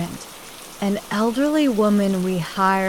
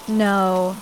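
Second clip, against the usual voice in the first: usual speaking voice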